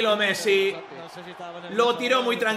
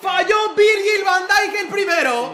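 excited shout; 8 semitones higher, 5 dB louder than usual. Real, not acted. Penalty missed, still shouting.

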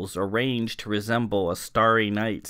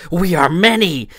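higher, much louder, a sudden savage roar; spring at them roaring; ferocious roar; 7 semitones higher, 10 dB louder than usual. A narrator's reading. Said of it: "she roared with sudden ferocity".

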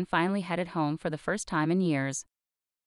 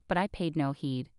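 usual speaking voice

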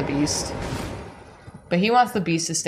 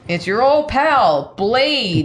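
higher, louder, slow dog-man voice; wolf voice; 7 semitones higher, 6 dB louder, slower than usual. Pretend. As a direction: ham up the friendly dog man. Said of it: "Blade the dog man".

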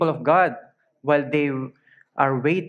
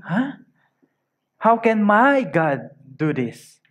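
higher-pitched, baffled questioning; a puzzled "Huh?", then slow, higher disbelief; perplexed; 5 semitones higher, slower than usual. Acted. Preacher voices a weary believer questioning God, perplexed. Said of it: "perplexity. And he was saying".

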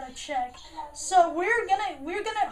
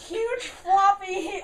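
mocking echo; much slower than usual. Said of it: "That sounded so wrong".

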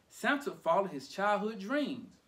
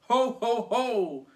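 very loud Santa ho-ho-ho; big, raised, booming laugh; 6 semitones higher, 9 dB louder, slower than usual. A narrator's reading. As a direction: boom it out like Santa. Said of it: "boomed Santa".

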